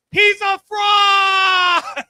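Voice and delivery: loud, slow, high accusing yell; mock shout. Kid turning to the audience to accuse, loud slow shout. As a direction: call him out to the whole room.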